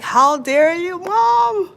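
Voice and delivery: high, loud, indignant cry at mom; mock outrage. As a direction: shoot it up high and loud, a hammed-up how-dare-you. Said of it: "I was like".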